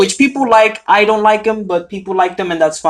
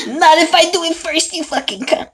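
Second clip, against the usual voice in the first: high, joking menace; mock threat; 10 semitones higher than usual. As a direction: fire back a fake threat.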